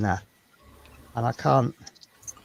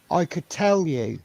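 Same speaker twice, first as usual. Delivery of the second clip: ordinary talk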